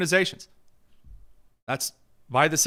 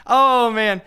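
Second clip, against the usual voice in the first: laughing; 8 semitones higher, 7 dB louder than usual. Real, not acted. Recovering from laughter.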